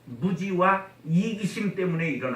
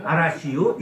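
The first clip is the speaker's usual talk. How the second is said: usual speaking voice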